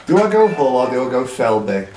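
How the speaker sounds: usual speaking voice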